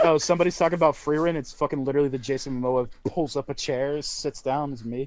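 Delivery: ordinary delivery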